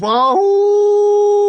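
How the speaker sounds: a soldier howling for cigarettes; wolf howl. Retold or imitated. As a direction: throw back your head and howl like a wolf. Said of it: "howl like a wolf".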